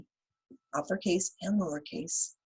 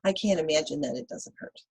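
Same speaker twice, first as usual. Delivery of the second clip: normal talking voice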